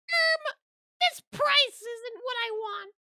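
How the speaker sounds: squeaky, very high, slow whine of a Karen; mock Karen complainer. Customer grumbling to the company about a price, mock Karen squeak. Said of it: "I'm not about to Karen somebody".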